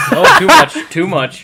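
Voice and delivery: laughing loud protest. Real, not acted. Sound effects too loud.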